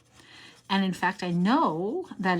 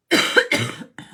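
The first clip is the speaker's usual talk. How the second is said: harsh rough cough; coughing fit; 10 dB louder than usual. Real, not acted. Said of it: "I'm really sorry I'm coughing so much".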